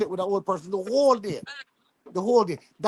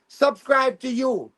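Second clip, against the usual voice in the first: slow announcement; 6 semitones higher, 3 dB louder, slower than usual. Retold or imitated. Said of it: "he can say".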